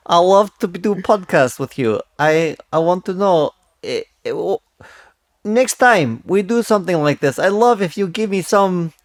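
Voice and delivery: lightly put-on Russian accent, groping for idioms; a Russian guest fumbling for English words. Co-host greets Daniel in a light put-on Russian accent. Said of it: "the Russian character that you're doing".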